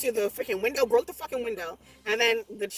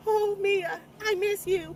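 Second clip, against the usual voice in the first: high, mock tearful plea; imitating crying boy; 10 semitones higher, slower than usual. Possessed boy crying to Mia, high mock sob. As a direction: cry it out in a pitched-up voice. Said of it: "he starts to cry like".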